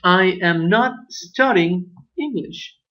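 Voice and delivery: slow, careful model sentence; slow example sentence. Shown as a demonstration. Teacher modelling an English sentence for learners, slowly. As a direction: read the example out slowly and clearly. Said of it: "Por ejemplo".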